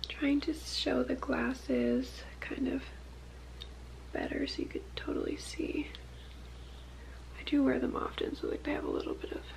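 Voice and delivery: soft whisper, slowed at first. Real, not acted. Showing viewers the glasses up close, whispering.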